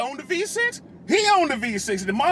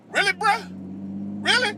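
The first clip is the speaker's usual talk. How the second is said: incredulous; 9 semitones higher than usual. Real, not acted. Incredulous at truck rims on car.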